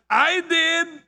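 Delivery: high, boastful blurt; proud. Acted. Frog answering the farmer's praise, proudly. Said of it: "the frog opened his mouth and proudly said".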